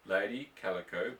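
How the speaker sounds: usual speaking voice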